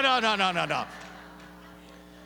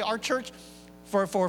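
ordinary talk

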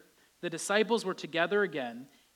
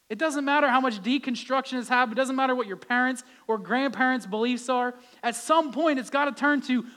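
louder, driving sermon; fervent preaching; 5 semitones higher, 6 dB louder than usual. Acted. Preacher urging the congregation, louder and driving. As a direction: preach it with conviction.

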